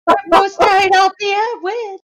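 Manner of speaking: laughing loudly. Real, not acted. Laughing chaos after joke.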